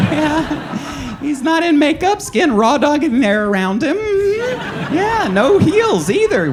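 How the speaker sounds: high, campy queen throwing shade; sassy drag queen voice. Retold or imitated. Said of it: "drag crowd reacting to him".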